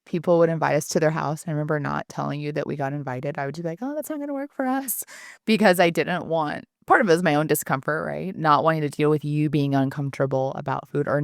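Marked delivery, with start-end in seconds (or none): 3.8-5.0 quick, high-pitched brush-off; imitated polite refusal, pitched up; breezy excuse. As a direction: decline fast in a higher, lighter voice. Voices her past excuse to invitations.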